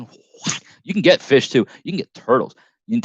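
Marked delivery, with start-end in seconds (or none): none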